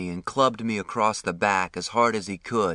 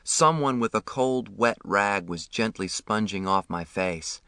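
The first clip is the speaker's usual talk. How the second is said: ordinary delivery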